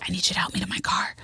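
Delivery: quick hushed whisper; retold secretive whisper. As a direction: whisper it fast, as if nobody should hear. Retelling a secret phone request.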